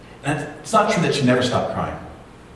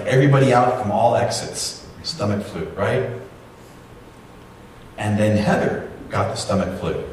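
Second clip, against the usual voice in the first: ordinary talk, nothing marked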